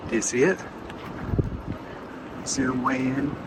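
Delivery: usual speaking voice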